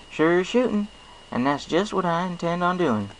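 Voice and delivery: folksy farm pony, friendly and sure; Applejack. Acted. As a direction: play a cheerful country mare with a twang. Applejack's voice in story.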